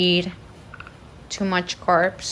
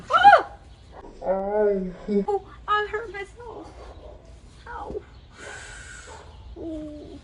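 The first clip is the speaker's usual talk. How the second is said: hurt, whimpering in pain; pained crying; 8 semitones higher, 8 dB quieter, much slower than usual. Real, not acted. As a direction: cry out softly from real pain.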